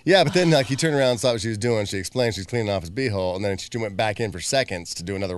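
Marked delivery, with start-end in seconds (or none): none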